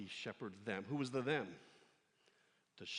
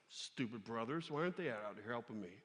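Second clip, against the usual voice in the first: sulky attitude; 5 semitones lower, 4 dB quieter than usual. Pretend. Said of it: "He could have attitude with his brothers".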